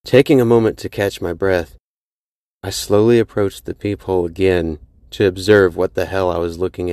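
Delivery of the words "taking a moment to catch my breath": usual speaking voice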